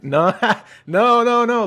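high, loud frantic no's with a nervous laugh; panicked refusal. Retold or imitated. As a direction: back away pleading, scared. Kid panicking as grandma chases.

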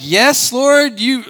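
hammed-up show of faith; mock pious prayer. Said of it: "I want to act like".

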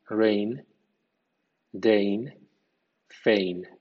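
rain, deign, feign all ending in "ein", g silent; English pronunciation demo. Shown as a demonstration. Teacher models eign words for learners, careful pronunciation. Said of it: "suena ein".

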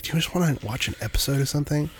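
softly rushed little ask; quiet quick self-quote. Retold or imitated. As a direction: toss it off lightly and quickly. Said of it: "I'm like".

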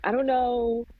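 dramatic, drawn-up disbelief; exasperated venting. Retold or imitated. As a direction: complain in mock outrage about a terrible child. Venting about a four-year-old, retold to mom.

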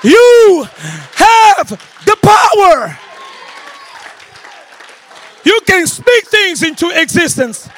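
loud, high, shouting preacher building to a crescendo; preaching at full force. Acted.

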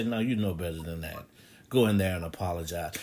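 low, quiet fatherly admonition; a stern dad keeping his voice right down; dad's voice. Acted. God as your late father, correcting you: low, quiet dad voice. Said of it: "he sounds just like your dad".

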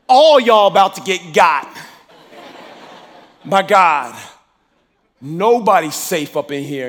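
loud, declaring it to the room; bold swagger. Retold or imitated. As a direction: announce it like you own the place. Strutting in like a sheriff.

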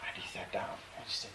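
ordinary delivery

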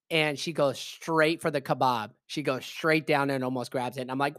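normal talking voice

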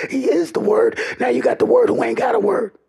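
ordinary delivery

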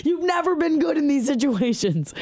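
high, exasperated mock scolding; annoyed sister. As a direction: imitate a fed-up sister telling you off, pitched well up. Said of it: "she was so annoyed with me".

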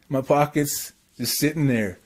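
usual speaking voice

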